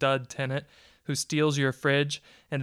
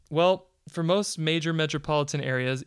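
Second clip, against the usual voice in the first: ordinary delivery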